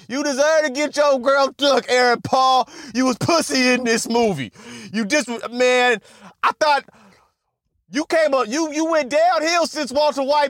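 high, heated scolding; roasting the actor for his role, heated; mock scolding. Real, not acted.

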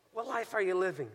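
ordinary delivery

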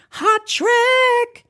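very high, loud drawn-out squeal; mock hype shout. Host announcing Brother Kerry's testimony, mock hype shout. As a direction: hype it like a hit record drop. Hyping the reading like a record.

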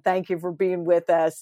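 usual speaking voice

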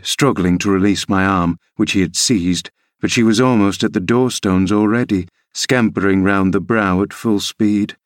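ordinary delivery

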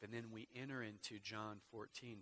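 ordinary talk

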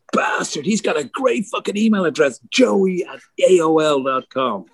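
high, jealous Pete voice; a grudging high-pitched imitation; quoting Pete. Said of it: "He goes".

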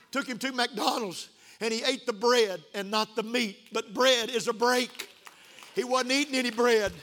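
high, loud, excited preaching; fervent testimony. Real, not acted. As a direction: testify with joy, punching the key words. Testifies at full preaching force.

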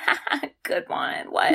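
soft, breathy fake chuckle; half-laugh then balk; mock nervous laugh. Mom hearing Dad is Santa, soft and breathy. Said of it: "And then she's like".

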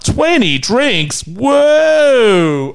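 high, drawn-out chorus of disbelief; mock astonishment. Band reacts to twenty drinks, exaggerated astonishment. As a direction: stretch it into a loud, hammed-up whoa. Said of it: "the whole band stopped what they were doing".

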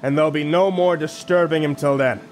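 gruff captain's barked command; barking. Acted. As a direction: bark the order at the crew. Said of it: "he barked".

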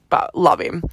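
ordinary talk, nothing marked

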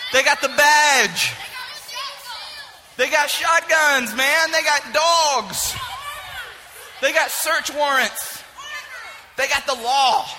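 preaching climax. Real, not acted. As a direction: hammer each "they got".

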